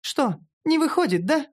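high, mocking female character question; high-pitched taunting; teasing smirk. A narrator's reading. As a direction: needle him gleefully. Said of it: "усмехнулась эльфийка".

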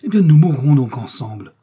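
full-voiced dramatic outburst; impassioned cry. A narrator's reading. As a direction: cry out a desperate resolve. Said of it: "s'écria-t-il".

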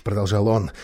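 ordinary delivery

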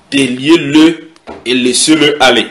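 loud, slow, drawn-out order; weighty biblical command. Acted. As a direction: proclaim the command with force. Reads Jesus's command aloud.

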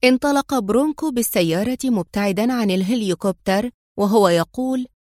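ordinary delivery

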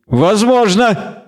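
drawn-out, high-pitched concession; slow, mocking half-elf reply; smirking. A narrator's reading. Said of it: "не переставая усмехаться".

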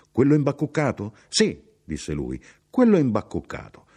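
high, insistent chatter; talkative traveller, pressing his point. Acted. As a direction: press the point eagerly on a stranger.

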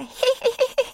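very high-pitched creature giggle; squeaky giggle. A narrator's reading. Tiny creature giggles at heroine, squeaky. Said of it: "раздалось писклявое".

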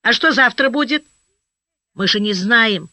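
usual speaking voice

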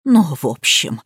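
low, reluctant hedging; falter, avoiding the answer; hesitant. A narrator's reading. Housekeeper evading question about a death, hesitant. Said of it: "замялась домработница".

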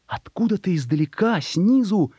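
plain reading voice

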